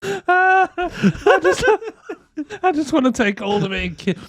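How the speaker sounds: high, laughing stop-start delivery; helplessly giggling through the words; cracking up. Real, not acted. Retelling the woman's lines to a mate, cracking up.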